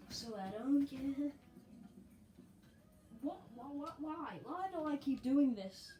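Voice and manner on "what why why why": normal talking voice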